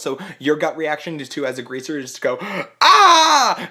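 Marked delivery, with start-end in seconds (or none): 2.8-3.7 sudden high shriek of shock, much louder; startled scream. Retold or imitated. Greaser shrieks on stage at the bald Rizzo. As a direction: shriek as the wig drops. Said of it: "you literally scream on stage".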